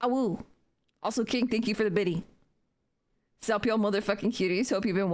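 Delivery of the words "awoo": short playful wolf howl. Pretend. Second howl to the dog.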